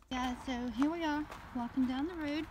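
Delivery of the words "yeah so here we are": ordinary talk, nothing marked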